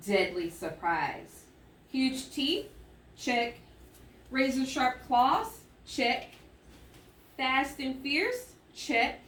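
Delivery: slow, deliberate read-aloud with a pause before each check; reading aloud to children. Shown as a demonstration. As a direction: tick off each trait like a checklist. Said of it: "watch me as I read".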